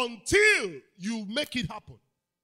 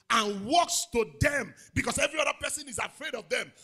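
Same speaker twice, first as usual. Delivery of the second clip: full-force preaching; 4 semitones higher, 4 dB louder than usual. Acted. Pastor telling the church that cult boys are afraid, loud.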